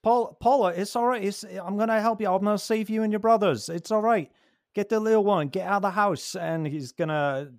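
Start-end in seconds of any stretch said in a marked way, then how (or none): 0.0-6.3 high, loud, hurried pleading; urgent protective father. Acted. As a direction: urge a child to escape right now. Father urges daughter to flee.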